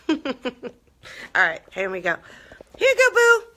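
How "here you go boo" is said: high, loud coo to the squirrel. Real, not acted. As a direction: call him over sweetly, much higher and louder. Feeding the squirrel, cooing.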